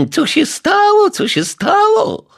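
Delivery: high, loud mocking echo of "co się stało"; snapping mockery. A narrator's reading. Neighbour mocks the detective's question, shrill snapping echo. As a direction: throw "co się stało" back at him with scorn. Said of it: "fuknęła pani Majewska".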